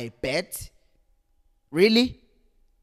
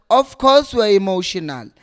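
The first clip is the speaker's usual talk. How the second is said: louder, insisting; emphatic; 3 semitones higher, 8 dB louder than usual. Real, not acted. Fan defending fellow fans, emphatic. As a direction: drive the point home. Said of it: "I'm already emotional".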